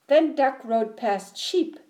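ordinary delivery

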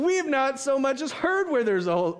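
high, exaggerated answer; animated high-voiced quoting; put-on disciples' reply. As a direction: pitch the reply way up. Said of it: "Maybe that's how they said it".

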